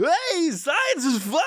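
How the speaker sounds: very high, slow goofy scientist voice; squealing dorky presenter. Retold or imitated. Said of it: "playing a fucking science guy on T V".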